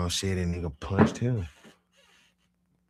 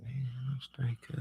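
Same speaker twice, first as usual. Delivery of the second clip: breathy whisper, no voice; 7 semitones higher, 7 dB quieter than usual. Retold or imitated. High cousin whispers he's okay, hushed. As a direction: whisper that you're fine. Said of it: "Then he like".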